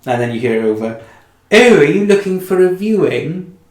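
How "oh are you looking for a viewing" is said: receptionist voice. Acted. Receptionist over intercom, higher.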